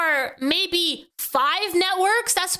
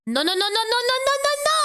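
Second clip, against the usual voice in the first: rising protest; 9 semitones higher, much faster than usual. Real, not acted. Protesting interruption.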